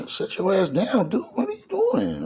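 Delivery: high-pitched retold telling-off; acting out scolding a friend into staying put; exasperated scolding. Narrator telling Duke to stay home, exasperated scolding.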